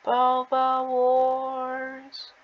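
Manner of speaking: slow dramatic movie title; movie title announcer. Pretend. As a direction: announce it like an epic film trailer.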